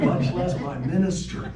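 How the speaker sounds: loud, high-pitched punchline; mock outrage. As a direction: belt it out as a scandalized friend. Friend's mock outrage, punchline of story.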